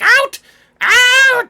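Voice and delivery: high, very loud yell; shouting. Acted. Gnome pushing a Goliath out of his shop, shouting. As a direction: yell it while shoving with all your might. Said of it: "As he's trying to push you".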